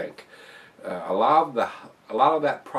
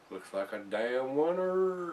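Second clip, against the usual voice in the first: slow, higher, stretched-out approval; drawn-out verdict; 5 semitones higher, slower than usual. Real, not acted. Reviewer declaring the beer a winner, drawn-out verdict. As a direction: stretch the last word out with relish.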